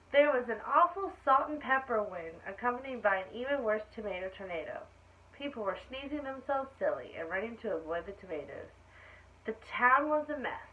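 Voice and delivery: put-on lively read-aloud for children; animated story reading; storybook narration. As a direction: tell it like a bedtime picture book. Reads picture book aloud.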